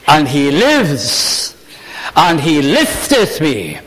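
slow, exultant, savoring each word; joyful proclamation. Acted. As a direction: announce it like the greatest news ever, slowly. Mary proclaiming resurrection, joyful.

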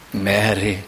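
"Mary" said low and soft, falling gently; tender Mary. Shown as a demonstration. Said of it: "How do you say it?".

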